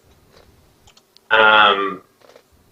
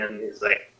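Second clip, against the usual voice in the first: ordinary talk, nothing marked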